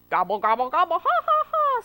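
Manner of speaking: rising, loud gobbling turkey; turkey mocking with gobbles; gobbling laugh. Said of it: "said the turkey".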